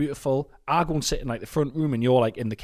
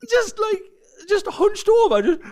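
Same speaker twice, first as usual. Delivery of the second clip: high-pitched laughing storytelling; 6 dB louder than usual. Real, not acted. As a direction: laugh through the telling. Laughing while retelling crying.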